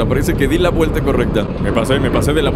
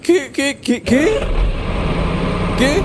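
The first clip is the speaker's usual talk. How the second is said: sudden high "qué" over and over; can't believe what just happened; stunned disbelief; 11 semitones higher, much slower than usual. Real, not acted. Hostages taken away.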